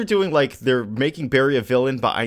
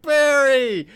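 desperate call; 4 dB louder than usual. Acted. Wally calls after fleeing Barry.

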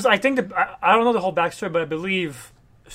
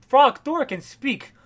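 mock surprise; 6 semitones higher, 5 dB louder, slower than usual. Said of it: "Loki's like".

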